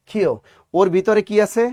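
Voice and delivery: ordinary delivery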